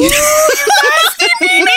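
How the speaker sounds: laughing shout. Real, not acted. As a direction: shriek it out through laughter. Shocked teasing at creamy remark.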